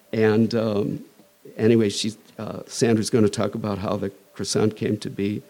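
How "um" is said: usual speaking voice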